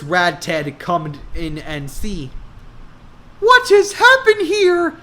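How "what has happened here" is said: very high loud horrified cry. Acted. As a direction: gasp it out in high horror. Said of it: "he is horrified".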